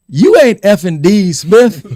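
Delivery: loud, drawn-out East Coast comeback; East Coast accent. Retold or imitated. Player talking back to the coach, loud East Coast accent. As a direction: shout it slowly, high, in an East Coast accent. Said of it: "that East Coast accent of his".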